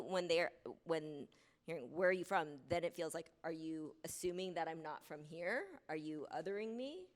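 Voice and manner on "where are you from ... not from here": ordinary delivery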